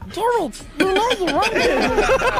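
high, slow voice through laughter; voiced talking fingers. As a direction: lend the fingers a high, drawn-out voice. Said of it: "my fingers were looking at me like".

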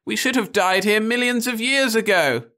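very high, shrill wail; wife's shrill lament. A narrator's reading. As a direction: rise shrill over the noise. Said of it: "voice rose shrill".

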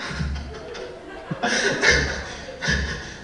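long unvoiced giggle; breathy giggle. Real, not acted. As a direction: snicker at your own pun. Laughing at own pun.

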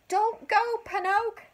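high, loud cricket warning. A narrator's reading. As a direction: call out to stop him. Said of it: "warned Jiminy Cricket".

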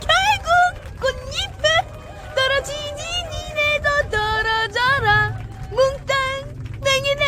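high, taunting put-on voice; mocking villain voice. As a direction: sneer at the fools gleefully. Taunting spring-haters skit voice.

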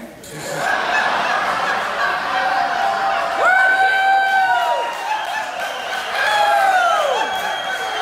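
loud burst of laughing; the room erupts laughing; roaring crowd laughter. Real, not acted. Guests at the wedding laughing loudly at the joke. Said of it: "crowd roars".

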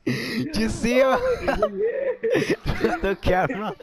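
talking through laughter; laughing. Real, not acted. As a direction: laugh while asking. Laughing at the game moment.

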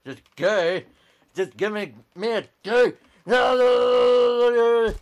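frantic rising screaming no; panicked shout. Real, not acted. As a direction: yell in alarm as it goes wrong. Game kills him, panicked protest.

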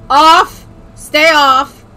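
loud firm command. Pretend. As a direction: order it to stay off. Commanding the setting.

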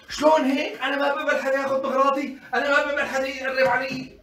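hammed-up indignant scolding; acted indignation. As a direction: play a mother loudly objecting. Acted mother protesting taken belongings.

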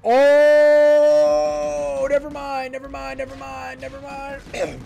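long loud high "oh" fading into high whimpering; panicked retreat on one high held pitch; comic panic. Real, not acted. Attack suddenly goes wrong.

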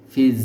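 ফিজ with a long buzzing z like a cricket at night; buzzing z demo. Shown as a demonstration. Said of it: "গভীর রাতে যখন ঝিঝি পোকা আওয়াজ করে, এরকম". (ঝিঝি পোকার মতো গুঞ্জন দিয়ে ফিজ)

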